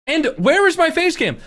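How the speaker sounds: high, loud indignant shouting; outraged yell. Real, not acted. Complaining about chipmunk voice.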